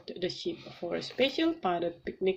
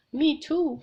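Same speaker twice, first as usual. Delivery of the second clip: higher, louder storybook call; 6 semitones higher, 6 dB louder than usual. A narrator's reading. Daddy Pig answers Peppa in the helicopter, calling out. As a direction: call back, short of a shout. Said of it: "called Daddy Pig".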